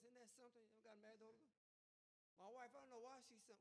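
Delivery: usual speaking voice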